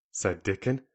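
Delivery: ordinary delivery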